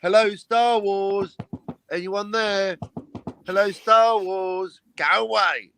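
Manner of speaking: mock drawn-out hailing call. Mimics calling out into the film.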